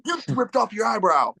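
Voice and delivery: high, startled "you ripped off your eyebrow"; shocked exclamation. Retold or imitated. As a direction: act out a teammate's stunned shout.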